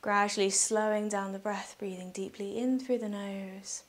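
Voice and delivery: soft, unhurried meditation voice with long pauses; guided meditation. Real, not acted. Yoga teacher guiding listeners through breathing, calm guided meditation.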